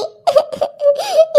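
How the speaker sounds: acted sobbing. Daughter-in-law overwhelmed at home, acted sobbing. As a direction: break down in short sobs. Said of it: "रुला दिया ना बेचारी बच्ची को".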